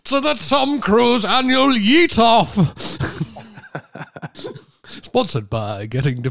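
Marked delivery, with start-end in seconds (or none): 0.0-2.6 slow, loud, booming event announcer; grand mock welcome, drawn out. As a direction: announce it like a big sports show.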